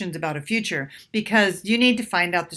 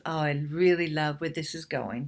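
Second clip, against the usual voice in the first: soft, low, intimate murmur to a date; intimate softer demo; 5 semitones lower, 6 dB quieter than usual. Shown as a demonstration. Woman to her date while kissing, soft and tender. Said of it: "What you can say is".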